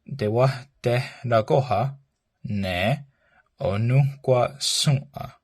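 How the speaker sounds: slow, careful model of the phrase. Shown as a demonstration. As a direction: say it slowly for learners to copy.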